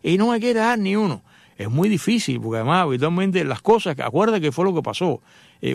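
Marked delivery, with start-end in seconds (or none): 0.0-1.2 higher, mock reproachful wife voice; wife's complaint. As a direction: imitate the wife's nagging plea. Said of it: "Cuando tu mujer te dice". (esposa reclamando, voz más aguda)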